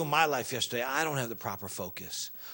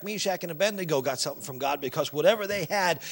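ordinary talk

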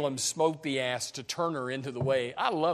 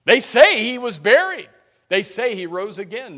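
excited disciple; 8 semitones higher, 11 dB louder than usual. Acted. Disciples voiced, repeating rumors.

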